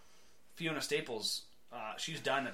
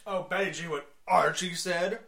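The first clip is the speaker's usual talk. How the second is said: louder, higher sly needling; a hint of a taunting bully; 4 semitones higher, 7 dB louder than usual. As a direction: tease her like the school jerk. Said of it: "Reggie would do stuff like".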